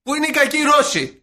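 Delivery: high, loud pleading shout; mock indignant demand. Longing for simple old villains, mock indignant demand. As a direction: cry out the question in mock outrage.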